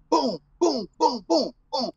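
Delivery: high, punchy "boom" for each hit; imitated blows. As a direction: act out a beating as repeated booms.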